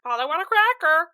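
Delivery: high squawky parrot, loud. Pretend. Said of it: "a better parrot voice than I thought I could do".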